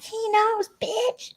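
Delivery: squeaky, very high taunting jab; very high mock sneer. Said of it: "Amber's like".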